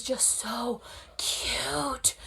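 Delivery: soft, drawn-out whispery gush; hushed gushing. Real, not acted. Fan gushing over a sweet moment, hushed and drawn out. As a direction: gush softly, stretching the words out.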